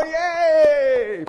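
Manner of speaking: high, drawn-out mock yay; crowd cheering. Pastor imagining moviegoers cheering cheaper popcorn, high long cheer. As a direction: cheer like a delighted queue. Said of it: "everyone would go".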